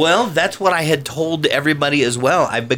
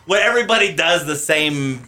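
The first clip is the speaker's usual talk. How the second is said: ordinary talk, nothing marked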